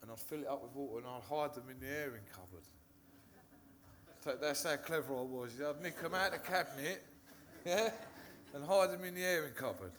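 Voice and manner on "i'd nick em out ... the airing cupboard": laughing through the words, louder and higher. Real, not acted. Recovering addict admits hiding vodka bottles, laughing. Retelling childhood vodka trick.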